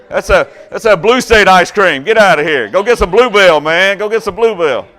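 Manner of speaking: loud, high playful rant; hammed-up hollered scolding; mock outrage. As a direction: send them packing in pretend indignation. Mock outrage over ice cream.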